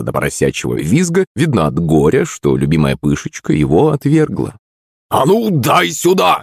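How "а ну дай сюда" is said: put-on high, drawn-out drunken demand; drunken yell. A narrator's reading. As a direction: call out like a belligerent drunk. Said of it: "услышал я его пьяный крик". (пьяный крик)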